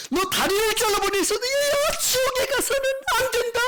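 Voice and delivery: full-force, very high pleading wail; God crying out. Retold or imitated. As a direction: shout with all your might to hold someone back. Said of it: "이렇게 외치는 거잖아요". (온 힘을 다해 높게 외치는 소리)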